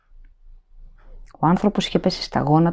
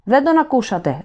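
high, raised shriek; shrieking panic; 7 semitones higher than usual. A narrator's reading. Prisoner shrieks at the guards, panicked shriek. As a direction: voice rising into a shriek. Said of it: "η φωνή του υψώθηκε και έγινε στριγλιά".

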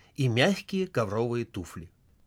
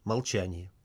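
usual speaking voice

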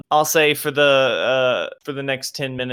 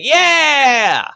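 high, loud group cheer; crowd cheer; 13 semitones higher, 7 dB louder than usual. Acted. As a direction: cheer like a rowdy bar crowd.

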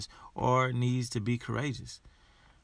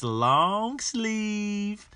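slow, much higher stretched voice; drawn-out high voice; 9 semitones higher, 3 dB louder than usual. Pretend. Host plugging shirts, slow and high.

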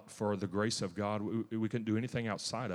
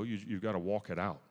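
ordinary talk, nothing marked

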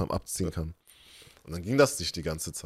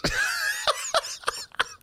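high, wheezy burst of laughter; laughing; 3 dB quieter than usual. Real, not acted. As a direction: crack up helplessly. Laughing while telling the story. (hohes, atemloses Lachen)

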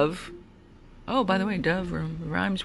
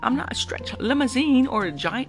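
higher-pitched, exasperated rant; exasperated scolding; 7 semitones higher than usual. Real, not acted. Driver fed up with riders not masking, exasperated. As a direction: plead in exasperation, voice lifted.

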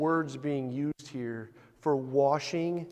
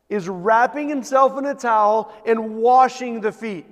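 louder, higher, slow emphatic preaching; 6 semitones higher, 9 dB louder than usual. Acted. Pastor pressing the congregation, full-voiced preaching. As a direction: build the sermon to a ringing peak.

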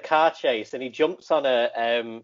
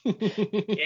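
ordinary talk, nothing marked

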